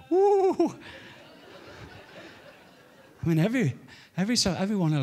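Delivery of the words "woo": high squealed woo of delight; 1 imitated joyful woo. Pastor in the chair, high delighted woo. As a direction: let out a sudden high woo. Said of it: "he's like".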